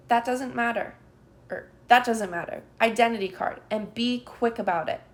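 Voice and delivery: loud curt orders; stern officer. A narrator's reading. Officer dismissing the child and demanding papers, stern. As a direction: cut the child off sharply.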